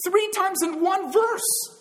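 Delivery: THREE hit high and stretched out; emphatic. Real, not acted. Preacher stresses Three to his congregation, emphatic. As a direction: lean hard on the word Three.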